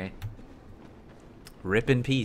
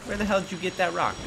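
fast, higher, indignant; exasperated; 9 semitones higher, faster than usual. Real, not acted. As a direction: rattle it off in disbelief. Exasperated outburst at game.

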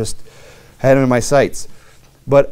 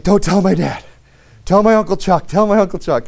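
put-on a bit higher, urgent child's plea; panicked kid pleading; 6 semitones higher than usual. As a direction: beg like a scared kid who messed up. Panicked kid begging, retold.

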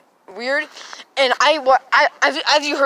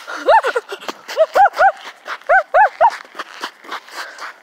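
sharp rising gasp of shock. Pretend. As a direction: gasp like you just got spooked. Hears the gremlin.

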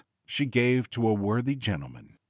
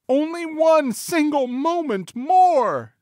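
very high, loud, stretched-out plea; pleading wife; 7 dB louder, slower than usual. A narrator's reading. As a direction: beg loudly in a high lady's voice. Wife begs Bluebeard for time.

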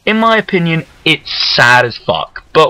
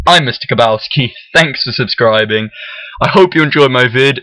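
usual speaking voice

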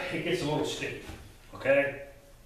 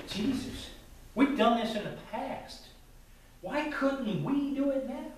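puzzled disciples; 7 semitones higher than usual. Acted. Disciples ask Jesus, puzzled.

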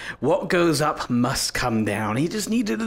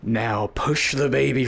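usual speaking voice

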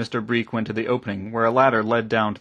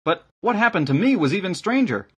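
high, keen youth; lad's raised, eager voice; village lad; 9 semitones higher, 4 dB louder than usual. A narrator's reading. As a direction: top the last story in a high young voice. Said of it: "said the lad".

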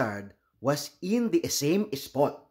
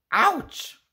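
sudden yelp, much higher; pained yelp; 12 semitones higher than usual. Acted. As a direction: yelp as a sharp rock bites the foot. Stepping on sharp rocks.

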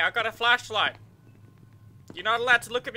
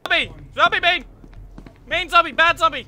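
scolding shout; 7 semitones higher, 8 dB louder than usual. Pretend. Scolding zombie in game.

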